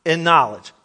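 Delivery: scripture reading. Acted.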